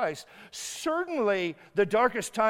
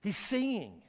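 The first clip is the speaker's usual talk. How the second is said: hushed aside; 2 semitones lower, 8 dB quieter than usual. Retold or imitated. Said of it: "you look at your friends and like".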